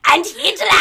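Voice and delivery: put-on loud, high German-accented shout; mock German accent. Podcaster showing co-host how demon says it, loud German accent. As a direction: bellow it in a hammed-up German accent. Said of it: "that little German accent".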